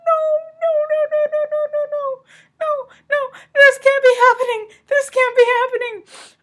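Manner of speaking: high, wailing no no no, half crying; mock distraught wail. Reader acting out her sobbing at a book, mock distraught wail. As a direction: act out falling apart over a sad book. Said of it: "I was just like".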